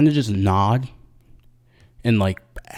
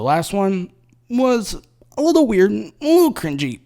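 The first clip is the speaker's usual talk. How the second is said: mock cringe; 10 semitones higher, 3 dB louder than usual. Real, not acted. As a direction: say it like it makes you wince. Mocking last year's video.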